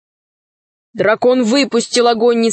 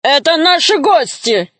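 higher, insistent ataman; robber chieftain; 6 semitones higher than usual. A narrator's reading. Ataman defends his guests, raised commanding voice. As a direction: assert your authority over the guests. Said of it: "Атаман разбойников тоже обернулся".

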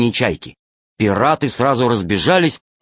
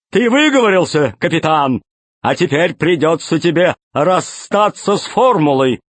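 fat pirate voice; 6 semitones higher than usual. Acted. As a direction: play the grinning pirate boss. Tolstyak threatens captain.

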